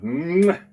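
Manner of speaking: a smacking kiss sound with a rising lilt; chef's kiss. Pretend. Cook listing the holiday menu, giving a chef's kiss. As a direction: blow a quick kiss of delight.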